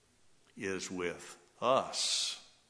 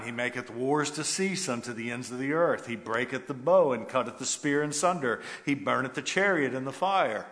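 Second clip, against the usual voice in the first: louder, ringing King James reading; forceful scripture reading; 4 semitones higher, 9 dB louder than usual. Acted. Preacher reading the psalm aloud to the church, proclaiming. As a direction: proclaim the verse like a herald.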